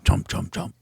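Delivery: whispered chomp chomp chomp; chomping noises. Retold or imitated. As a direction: mimic chewing in a whisper. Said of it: "that was my impression of Tim".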